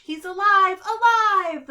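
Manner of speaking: high, loud gleeful cry; excited exclaim. A narrator's reading. Little terrors cheering their creation to life, excited shriek. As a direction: shriek it with mad-scientist glee. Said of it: "terrors exclaimed".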